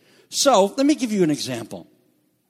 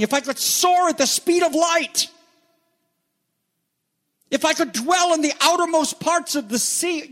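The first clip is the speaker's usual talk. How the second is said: high, ringing preacher's declamation; impassioned psalm recitation; 9 semitones higher than usual. Acted. Preacher recites David's words to God from the psalm, impassioned. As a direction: proclaim the psalm with rising fervour, pitch held high.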